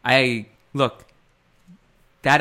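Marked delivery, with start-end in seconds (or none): none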